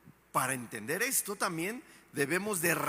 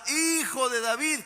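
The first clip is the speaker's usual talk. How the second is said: grito agudo y fuerte, suplicante; grito de Bartimeo; 8 semitones higher, 5 dB louder than usual. Retold or imitated. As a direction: clama al Hijo de David a todo pulmón. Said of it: "repito, le gritaba". (Bartimeo gritando desde el camino, súplica fuerte)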